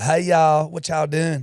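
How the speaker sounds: hammed-up Southern accent, high and loud; silly put-on Southern accent; exaggerated accent impression. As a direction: overdo a Southern greeting in a silly voice. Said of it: "makes it sound stupid".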